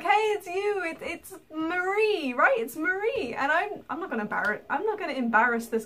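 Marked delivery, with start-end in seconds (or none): 0.0-3.3 sure he has the right name; higher beaming fan; eager fan. Retold or imitated. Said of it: "He was just going up to me like".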